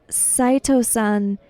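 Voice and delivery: ordinary delivery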